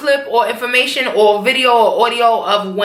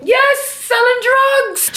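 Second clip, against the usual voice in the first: mock Tasha; 12 semitones higher, much slower than usual. Tasha repeating to Ghost.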